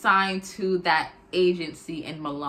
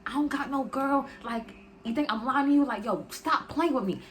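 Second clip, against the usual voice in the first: berserk; 4 semitones higher than usual. Retold or imitated. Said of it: "The dude goes berserk".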